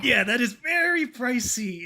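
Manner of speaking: slow, loud, high complaint; loud outcry. Real, not acted. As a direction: shout it out in mock pain at the price. Outcry at drink prices.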